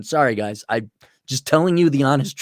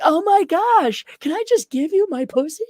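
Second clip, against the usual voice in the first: put-on gushing girl. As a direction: squeal it as an imitation of a smitten girl. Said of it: "And goes like".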